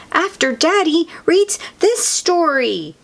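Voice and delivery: put-on high, insistent child character. A narrator's reading. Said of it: "Peppa insists".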